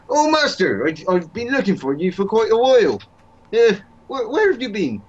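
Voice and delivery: bumbling servant finds his master; Baldric voice. Pretend. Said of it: "my Baldric".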